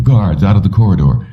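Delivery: plain reading voice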